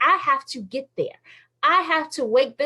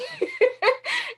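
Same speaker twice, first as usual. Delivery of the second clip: high squeal of laughter; laughing. Real, not acted. Speaker laughing at how she voices God. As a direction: crack up laughing.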